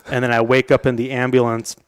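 ordinary talk, nothing marked

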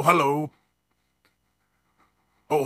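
usual speaking voice

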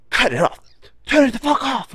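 high, loud barked order from the boss; angry boss yelling. Retold or imitated. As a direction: yell it like the boss ordering the saw off. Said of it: "and he's like".

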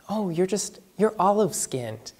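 delicate remark. Retold or imitated. People since childhood commenting on his skin, delicately mimicked. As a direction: tiptoe round it softly, imitating people.

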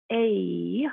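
A said as the long name sound. Shown as a demonstration. Teacher isolating the long vowel sound. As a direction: say A like its letter name. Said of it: "we hear that".

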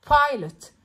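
بايلوت with a strong puff of air on the P; strong P in pilot. Shown as a demonstration. Teacher to class, showing the P: بايلوت with an aspirated P. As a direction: push air out of the mouth on the P of بايلوت. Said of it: "البي بي سترونج".

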